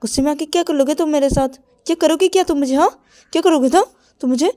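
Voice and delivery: high, loud mocking dare; shrieking taunt; Ravi taunting. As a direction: goad him, high and loud. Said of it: "तो मेरे बोलते".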